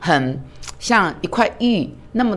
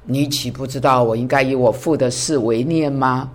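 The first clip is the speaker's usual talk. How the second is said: lower, gentle voice of Jesus; lightly voiced Jesus, lower and steady; the Son of God answering; 5 semitones lower than usual. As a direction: answer calmly as the young Jesus. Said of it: "神的儿子就这样回答你说".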